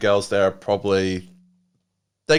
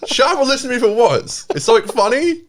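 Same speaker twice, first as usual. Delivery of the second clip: mock shout; 12 semitones higher, 4 dB louder than usual. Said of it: "what you just should have said".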